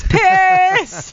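loud, high, drawn-out call; shouted sign-off. Retold or imitated. Employee walks out on manager for Halloween parties, shouting. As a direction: fling it out as a showy parting shout. Triumphant exit line in retold quarrel.

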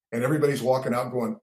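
usual speaking voice

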